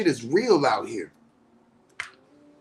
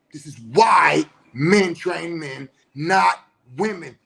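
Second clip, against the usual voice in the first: slow, louder, near-crying shout; loud emphatic repeat; 5 semitones lower, 5 dB louder, slower than usual. Real, not acted. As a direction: hammer it home slowly, voice cracking. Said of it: "repeats line for emphasis".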